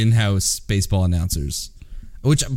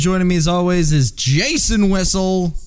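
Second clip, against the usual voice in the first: announcer voice; 9 semitones higher than usual. Shown as a demonstration. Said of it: "I love how I say it".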